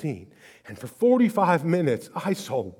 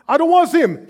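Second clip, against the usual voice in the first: upset mother; 12 semitones higher, 6 dB louder, faster than usual. Retold or imitated. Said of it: "She's like".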